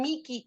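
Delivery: "Miki" with a hard k in the middle; Italian-style "Miki", k replacing the h. Shown as a demonstration. Teacher cites Italian pronunciation to student, "Miki" with hard k. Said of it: "a veces pronuncian Miki".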